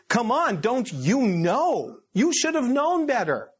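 high, pleading rebuke; exasperated scolding. Acted. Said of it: "he says".

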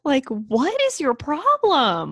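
high, slow, drawn-out exasperation; outraged disbelief. Real, not acted. Host berating the daughter, outraged disbelief. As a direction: stretch the question out in outrage.

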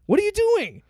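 high, exasperated disbelief; incredulous. Real, not acted. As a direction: throw up your hands in disbelief. Repeats the question much higher.

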